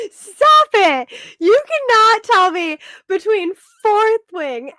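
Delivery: high, loud, incredulous protest; playful outraged disbelief. Real, not acted. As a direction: refuse to believe your friend, loudly. Playful disbelief at friend's answer.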